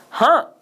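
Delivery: हाँ in one loud, high burst; हाँ punched out as one unbroken syllable; one-stroke syllable. Shown as a demonstration. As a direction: say हाँ whole in a single breath stroke. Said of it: "एक आघात में इसका उच्चारण हुआ".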